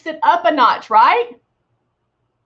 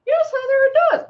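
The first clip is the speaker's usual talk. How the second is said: mock viewer reply; 13 semitones higher, faster than usual. Answers herself as viewer.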